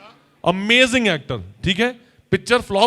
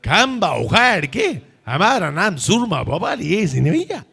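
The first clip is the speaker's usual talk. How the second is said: Soorma Bhopali line. Shown as a demonstration. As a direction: brag like a village braggart. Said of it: "and say". (Bhopali लहजे में शेखी बघारना)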